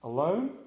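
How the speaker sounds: "Alone?" with a long upward glide; questioning. Real, not acted. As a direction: stretch "Alone?" into a rising question. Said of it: "Question mark, question mark, question mark?".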